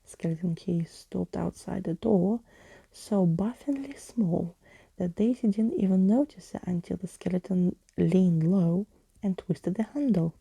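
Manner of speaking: usual speaking voice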